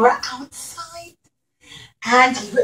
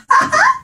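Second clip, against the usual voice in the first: high hearty laugh. Acted. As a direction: laugh off the little bee. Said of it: "started to laugh".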